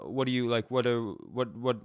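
ordinary talk

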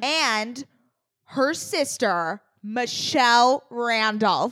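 loud, slow, drawn-out reveal; excited reveal. Real, not acted. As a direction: unveil the name like a prize. Second reveal.

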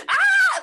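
very high mock shriek of a scared toddler; frightened scream. Little sister terrified by the pirate noise, mock scream. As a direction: shriek like a frightened two-year-old. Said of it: "scream really loudly".

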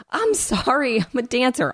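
imitating an apologetic friend, pitched high; high-pitched, hammed-up excuse; mock apologetic. Friend excuses her odd counting.

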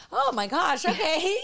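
high, loud, delighted gasp of wonder; amazed and laughing. Retold or imitated. Mother recalling her reaction to daughter, high delighted exclaim. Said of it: "and I".

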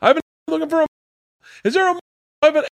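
high, strained calling out; retold yelling. Man calling out for the lost kid's parent, yelling. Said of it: "I go over and I still yell".

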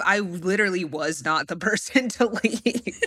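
laughing through the words. Real, not acted. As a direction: giggle as you admit it. Laughing at her own past life.

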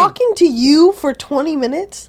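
incredulous. Real, not acted. Daughter mocks dad's request.

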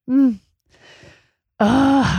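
drawn-out reluctant hesitation; reluctant groan. Retold or imitated. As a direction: imitate giving in grudgingly. Mother reluctantly gives in to child.

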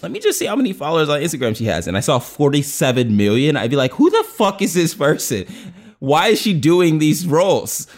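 high, loud disbelief; incredulous outrage. Acted. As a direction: build from quick curiosity into a high, loud outburst. Acting out an outraged viewer's reaction.

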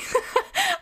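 a short high laugh breaking into the story; embarrassed laugh. Real, not acted. Laughing at her childhood self. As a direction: laugh at the memory of crying. Laughing while telling of crying.

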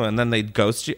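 ordinary delivery

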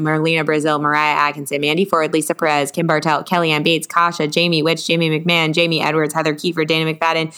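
rapid roll-call of names, pitch settling as air runs out; breathless non-stop list; one breath. Shown as a demonstration. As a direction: rattle off the whole list without breathing. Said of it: "read off in one breath".

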